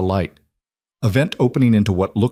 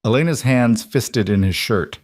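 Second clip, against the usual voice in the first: usual speaking voice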